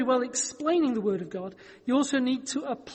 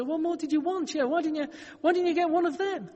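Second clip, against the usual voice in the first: exasperated God; 7 semitones higher than usual. Retold or imitated. God to the drowned man, exasperated and higher. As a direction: throw up your hands at him in exasperation.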